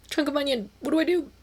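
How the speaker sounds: hammed-up dismay at the onion; mock-panicked cry. Retelling finding onion in soup.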